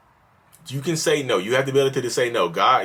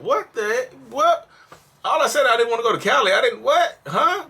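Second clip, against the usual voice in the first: 1 outraged disbelief; 13 semitones higher than usual. Retold or imitated. Acted quarrel after hearing no.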